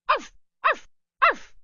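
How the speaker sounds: high yappy barks; dog barking. A narrator's reading. Little dog warning off the pirate, high yaps. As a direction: bark like a small dog, high and loud. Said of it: "said Sally Dog Little".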